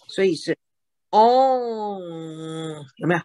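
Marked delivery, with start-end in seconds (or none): 1.1-2.8 "own" drawn out long, closing on a hummed nasal; nasal ending on own. Shown as a demonstration. Teacher showing a learner the nasal sound, "own" long and nasal. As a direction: let "own" ring on through the nose. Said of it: "有个鼻音出来".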